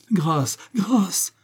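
high moaning plea for mercy; moaned plea. A narrator's reading. Ben Hamol on his knees moans to Zigomar. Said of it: "Gémit James Ben Hamol".

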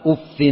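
أف with doubled f and an "-in" ending; kasra with tanween. Shown as a demonstration. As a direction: pronounce أف as "uffin". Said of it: "وضمها وكسرها بتنوين وبغير تنوين".

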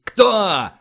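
loud, high bark; shouted demand. A narrator's reading. Admiral presses for who is boss, shouting. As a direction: explode on the single word.

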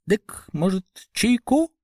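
rubbery high squeaky toy voice; piping little fat man; squeaky pipe. A narrator's reading. Толстячок снова предлагает чай. (писклявый голосок толстячка)

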